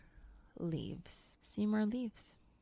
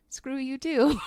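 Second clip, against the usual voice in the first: bear talking back; 6 semitones higher, 6 dB louder than usual. Retold or imitated. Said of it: "the bear is like".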